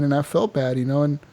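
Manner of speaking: ordinary talk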